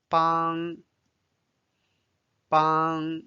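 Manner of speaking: 帮 held level in first tone; 帮 drawn out without rise or fall. Shown as a demonstration. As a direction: say 帮 on a sustained, even tone. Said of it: "帮 is the first tone".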